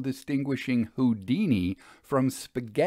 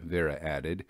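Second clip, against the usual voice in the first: usual speaking voice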